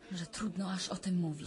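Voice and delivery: ordinary talk, nothing marked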